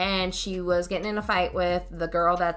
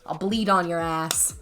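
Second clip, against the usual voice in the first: ordinary delivery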